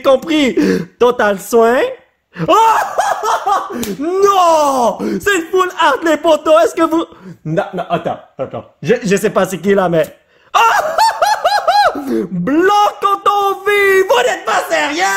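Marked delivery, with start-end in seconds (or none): 2.5-7.0 high, laughing shouts of disbelief; can't believe the pull; excited. Real, not acted. Découvre une carte full art. 10.5-15.0 laughing shout. Real, not acted. Carte incroyable, éclate de rire.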